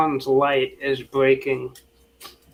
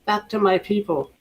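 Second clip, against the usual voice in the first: pleading mermaid; 6 semitones higher than usual. Acted. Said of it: "looks up to Adrian and says".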